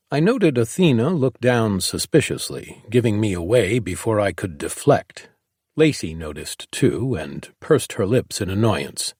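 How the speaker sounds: plain reading voice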